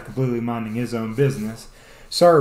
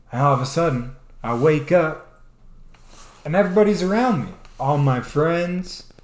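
ordinary talk

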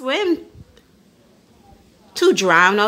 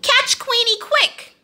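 urgent call; 12 semitones higher than usual. A narrator's reading. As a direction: shout to catch the dog. Duck calling the chase.

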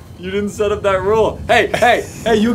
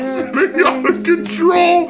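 high, drawn-out imitation of a wail; mock wail; 8 semitones higher, slower than usual. Player mimicking the rival's panic, mock wail. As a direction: stretch it high, laughing along.

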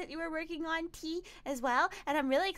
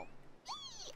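tiny squeal; 16 dB quieter than usual. Real, not acted.

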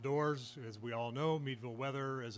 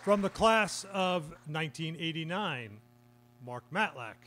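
announcer read; 2 semitones higher, 4 dB louder than usual. Real, not acted. Announces inductees by name.

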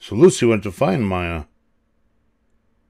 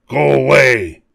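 louder, higher monster shout; howled; 5 semitones higher, 6 dB louder than usual. A narrator's reading. Monsters banishing Lucy, loud howl. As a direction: howl a sharp dismissal. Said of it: "they howled".

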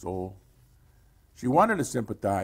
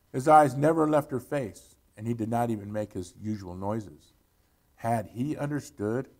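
ordinary delivery, nothing marked

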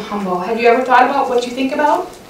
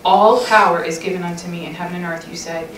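soft, unhurried prayer voice; closing prayer; 3 semitones lower, 6 dB quieter than usual. Real, not acted. Speaker praying aloud over the ladies, soft and earnest. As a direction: pray it gently over the listeners.

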